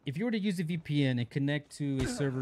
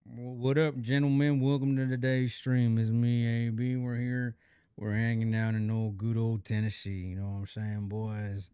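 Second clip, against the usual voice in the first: Southern twang; 4 semitones lower than usual. Pretend. Greeting chat in a put-on Southern accent as chat asked. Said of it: "Add more twang to your speech".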